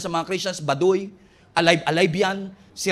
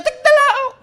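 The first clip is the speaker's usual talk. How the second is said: loud high quick cock crow; rooster crow; 10 dB louder, slower than usual. Acted. Rooster crowing over Peter's denial, loud crow.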